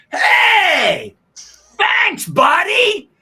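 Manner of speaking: high, loud, booming "hey thanks buddy"; imitating Bob Uecker's big cheerful hello; Uecker's hearty greeting. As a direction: belt it out like a big friendly announcer. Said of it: "And this is how he replied".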